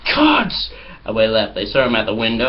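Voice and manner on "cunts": much higher, loud, spat-out curse; mock angry yell. The man at the window yelling at the pranksters, mock shout. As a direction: hurl it like a parting insult. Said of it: "And he was like".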